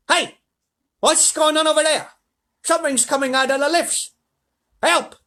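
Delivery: high, loud cries of panic; alarmed manager. A narrator's reading. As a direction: shout in mounting panic as the manager. Manager sees something attacking.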